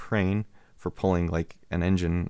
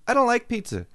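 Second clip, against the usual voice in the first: put-on high, louder curious-kid voice; nosy little kid; 4 dB louder than usual. Neighbor kid eyeing the man's pizza, high little-kid voice. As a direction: peek at the pizza like a small boy. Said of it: "He's like".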